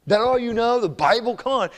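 high, exasperated scoff; frustrated mocking. Frustrated buddies mocking John's one verse, exasperated. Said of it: "They would get frustrated".

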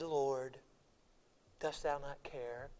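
quiet, slow, reverent question; soft and slow. Retold or imitated. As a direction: ask it softly, almost under the breath. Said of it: "I don't think she said".

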